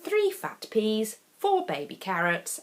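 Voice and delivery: usual speaking voice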